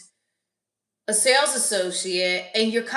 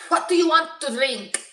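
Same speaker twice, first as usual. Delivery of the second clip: higher, hammed-up bad Spanish accent; exaggerated Spanish accent; 6 semitones higher than usual. Joking as the bartender, overdone bad Spanish accent. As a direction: push the fake Spanish accent for a joke. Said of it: "I have a horrible Spanish accent".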